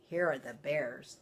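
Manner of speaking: ordinary delivery, nothing marked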